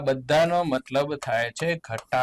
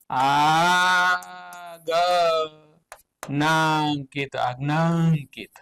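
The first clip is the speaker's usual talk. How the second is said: drawn out; much slower than usual. Shown as a demonstration. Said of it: "teacher drags out the Gujarati meaning".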